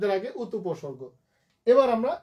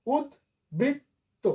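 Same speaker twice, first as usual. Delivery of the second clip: উদ্বৃত্ত slow and higher, as ud, brit, to; syllable by syllable; 5 semitones higher, much slower than usual. Shown as a demonstration. Teacher showing students the syllable break, slow and separated. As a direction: break উদ্বৃত্ত apart with long gaps. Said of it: "syllable যদি ভাঙে".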